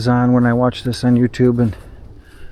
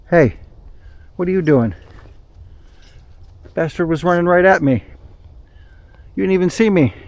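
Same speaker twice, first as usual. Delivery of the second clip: startled; 6 semitones higher, 4 dB louder than usual. Real, not acted. Startled by a squirrel running close.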